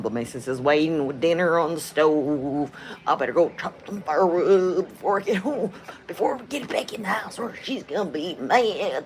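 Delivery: lightly put-on Southern cowboy drawl; a tired rancher heading home to the missus; Southern accent, slow and homey; mock southern drawl. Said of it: "have a southern accent".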